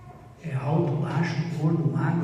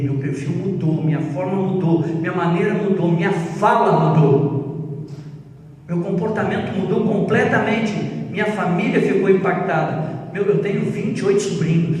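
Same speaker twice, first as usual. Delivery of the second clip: preaching build; 4 dB louder than usual. Acted. Preaching climax, louder.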